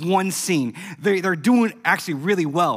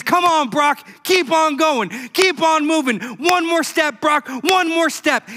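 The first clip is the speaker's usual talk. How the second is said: high, loud urging, slowing on one more step; cheering on; 9 semitones higher, 3 dB louder than usual. Retold or imitated. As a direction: spur him on, step by step. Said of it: "He says".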